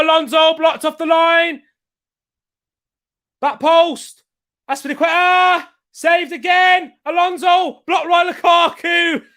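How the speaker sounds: high, shouted play-by-play; excited match commentary. Real, not acted. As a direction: yell each save as the chance unfolds. Live goal chance interrupts chat.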